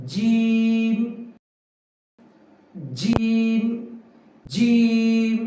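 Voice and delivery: জিম held long with lips in a slight smile. Shown as a demonstration. Teacher demonstrating jim pronunciation, smiling softly. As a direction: sustain জিম steadily, mouth shaped like a light smile. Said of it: "হালকা মুচকি হাসির ন্যায় রাখবো".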